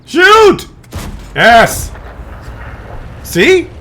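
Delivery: high, loud triumphant shout; excited yell. Real, not acted. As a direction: cheer the shot, loudly. Yelling as the player fires.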